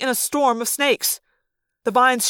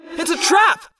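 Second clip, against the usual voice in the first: yelled warning; 9 semitones higher than usual. A narrator's reading. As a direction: shout the warning to your friends. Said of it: "I yelled".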